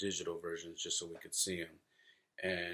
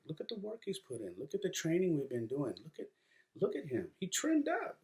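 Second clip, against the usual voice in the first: rising higher and higher in amazement; excited; 9 semitones higher than usual. Retold or imitated. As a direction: gush with proud disbelief. Dad marvelling at son's photo.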